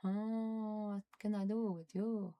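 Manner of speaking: usual speaking voice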